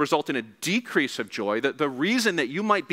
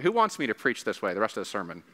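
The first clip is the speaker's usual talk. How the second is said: normal talking voice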